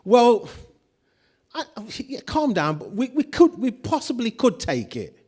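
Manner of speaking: mock-timid waffling over taking the land; hesitant, unsure. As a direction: hedge every word as if afraid to commit. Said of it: "He doesn't say to them".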